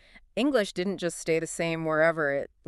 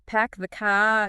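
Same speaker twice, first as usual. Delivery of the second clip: Boston accent; 3 dB louder, slower than usual. Shown as a demonstration. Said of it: "the Boston accent".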